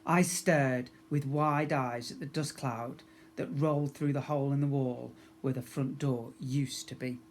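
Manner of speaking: usual speaking voice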